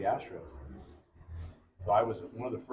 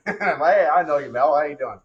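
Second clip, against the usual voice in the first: higher, louder retold hello; hearty greeting back; 6 semitones higher, 10 dB louder than usual. Pitcher greets Mel Wright back, hearty hail. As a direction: return the hail with raised pitch and volume.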